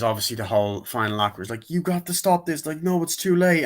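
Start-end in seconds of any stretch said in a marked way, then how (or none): 1.7-3.6 high-pitched urgent exchange; mock dramatic confrontation. Hero and villain in the final act, urgent. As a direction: act out the showdown in a raised voice.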